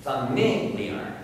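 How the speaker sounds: "Familiar" drawn slowly, stressed on the second syllable; slow down and lean on the MIL in "Familiar". Shown as a demonstration. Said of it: "It's in different syllables".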